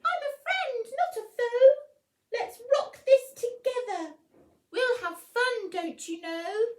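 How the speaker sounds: high, loud squeaky mouse voice with a laugh; a tiny friend rallying a big one; cheerful mouse. Acted. Mouse befriending the lion, high squeaky and cheerful. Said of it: "Mouse peeped".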